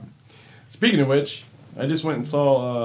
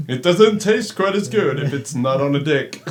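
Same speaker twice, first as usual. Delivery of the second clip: laughing loud quote; 4 semitones higher, 8 dB louder than usual. Retold or imitated. To his friend, retelling the sleep quote, a laughing shout. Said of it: "It's like".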